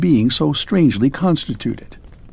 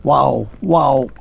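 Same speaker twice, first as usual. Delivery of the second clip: barking. A narrator's reading. Man turning into a dog barks.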